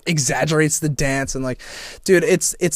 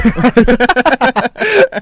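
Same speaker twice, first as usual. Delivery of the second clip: burst of laughter; 12 semitones higher, 11 dB louder than usual. Real, not acted. Laughing at own slip.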